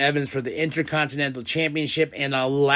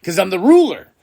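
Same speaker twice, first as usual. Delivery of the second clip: boastful; 6 semitones higher, 8 dB louder than usual. Retold or imitated. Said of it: "He's like".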